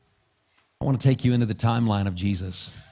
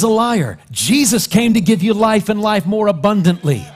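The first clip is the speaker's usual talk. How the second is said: loud, high preaching proclamation; preaching shout; 10 semitones higher, 10 dB louder than usual. Real, not acted. Preacher to congregation, a loud, high proclamation.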